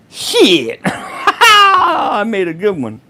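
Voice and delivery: slow words broken by real laughter; chuckling through it, pleased with yourself; laughing at own joke. Real, not acted. Vlogger cracking up at his own pronoun joke, laughing through the words. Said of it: "I'm over here laughing at myself".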